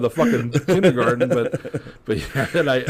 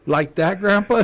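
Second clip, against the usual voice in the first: put-on high, eager little-kid question rising at the end; toddler voice; 9 semitones higher, 5 dB louder, slower than usual. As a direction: chirp it like a proud little girl. Toddler licks fingers to roll.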